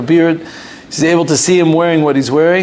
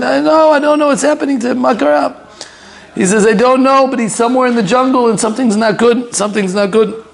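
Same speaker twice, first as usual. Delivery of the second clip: high, anxious, tumbling out; worried mother; 8 semitones higher than usual. Retold or imitated. Mother telling her daughter her son is in danger, high. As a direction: tell her daughter in a rush of worry.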